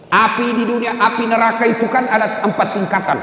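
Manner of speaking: fiery preaching. Acted. Preacher warning the men about hellfire, fervent preaching. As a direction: preach it with rising force.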